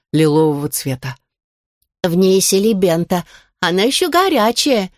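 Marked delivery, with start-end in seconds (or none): 2.0-4.9 light, thin little voice. A narrator's reading. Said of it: "тоненьким голоском доложила".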